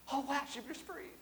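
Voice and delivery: high, soft, marveling; hushed wonder at a newborn; awed whisper. Retold or imitated. Father watching his baby breathe, hushed and awed. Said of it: "I was like".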